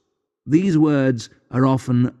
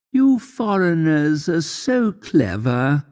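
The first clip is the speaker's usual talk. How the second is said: woman; 7 semitones higher than usual. Retold or imitated. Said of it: "a woman said to me".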